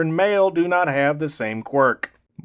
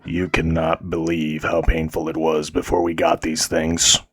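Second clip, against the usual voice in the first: voiced game character; 9 semitones lower than usual.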